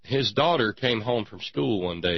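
usual speaking voice

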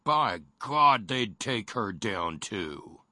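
slow, drawn-out mock threat; retold threat. Radio host retelling agents' threat to a wife, slow mock menace. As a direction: draw out the agents' threat, word by word.

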